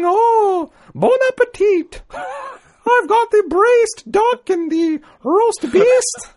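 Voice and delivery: slow, very high falsetto TV cook; hammed-up British lady cook; Julia Child. Shown as a demonstration. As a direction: draw out each dish in a matronly falsetto. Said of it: "I like Doubtfire or Julia Childs".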